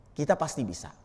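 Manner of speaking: hushed whisper to the crowd; quiet confident whisper. Acted. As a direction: drop to a breathy whisper of faith. Preacher hushes the congregation.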